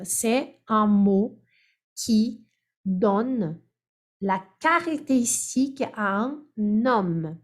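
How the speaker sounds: definition dictated slowly, with pauses; slow down so they can follow; slow dictation. Shown as a demonstration. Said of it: "C'est quoi adjectif?".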